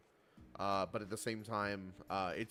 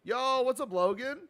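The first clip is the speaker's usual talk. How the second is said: excited; 12 semitones higher, 7 dB louder than usual. Real, not acted. Greeting chatter by name.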